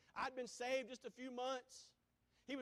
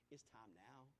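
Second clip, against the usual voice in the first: quiet; 12 semitones lower, 16 dB quieter than usual. Acted. Urgent call, said very low.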